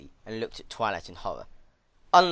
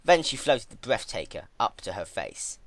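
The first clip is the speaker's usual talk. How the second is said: ordinary delivery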